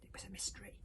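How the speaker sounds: ordinary delivery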